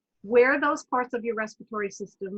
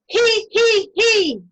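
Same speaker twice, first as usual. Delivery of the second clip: much higher, loud he, he from the throat; 10 semitones higher, 9 dB louder, much slower than usual. Shown as a demonstration. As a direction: giggle it out from the throat. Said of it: "take a deep breath in and".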